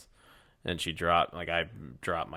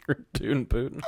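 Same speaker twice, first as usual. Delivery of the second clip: goofy cowboy voice; 6 semitones higher than usual. Pretend. Goofy cowboy nickname riff.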